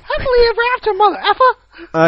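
very high cartoon squeak; high-pitched squeaky voice. Interrupting the co-host with a joke, high-pitched squeaky voice. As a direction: squeak it out, drawing out the end. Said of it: "high-pitched squeaky voice".

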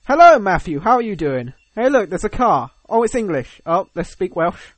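high, loud, hammed-up greeting; chatty villager impression. Welsh villagers spotting an English car, put-on chatty voice.

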